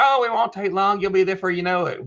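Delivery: lightly mimicked chorus of reassurance; breezy reassurers. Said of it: "Everybody's like".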